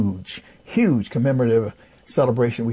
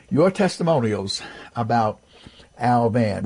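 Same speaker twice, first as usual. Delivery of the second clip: ordinary talk, nothing marked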